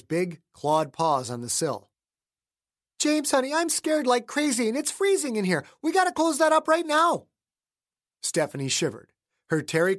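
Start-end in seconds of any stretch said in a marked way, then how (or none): 3.0-7.3 scared wife. Acted. Wife urging James to close the window, scared. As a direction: plead high and urgent.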